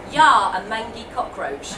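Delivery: loud, slow pirate insult. Shown as a demonstration. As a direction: sneer it like a pirate. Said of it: "repeat after me".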